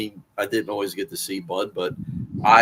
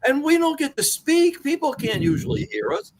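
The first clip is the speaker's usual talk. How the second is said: higher, fired-up comeback, pitched well up; worked-up reply to the theater gal; retold heated retort; 14 semitones higher than usual. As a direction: argue back with rising heat. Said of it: "And I said".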